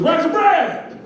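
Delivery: impatient shout at a store clerk; demanding shopper. Acted. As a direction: repeat it as a high, loud holler. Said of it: "He goes".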